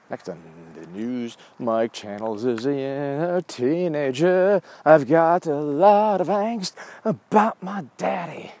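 slow, stretched-out parody voice, climbing as it goes; mock teen-angst teaser. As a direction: drag out every word in a rising, overblown delivery. Mock news teaser of angsty teenager.

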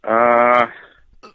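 normal talking voice

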